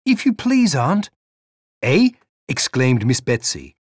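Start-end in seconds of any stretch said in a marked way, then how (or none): 0.1-1.1 put-on high, timid boy's voice; a small runaway boy pleading to his aunt; young boy, hesitant plea; boy nephew. 1.8-2.1 amazed. Acted. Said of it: "in a tone of amazement".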